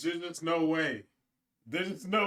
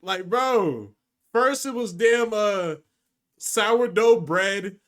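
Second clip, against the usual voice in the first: loud, raised protest; exasperated; 4 semitones higher, 7 dB louder than usual. Real, not acted. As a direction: complain loudly, stretching the key words. Gamer exasperated at rambling dialogue.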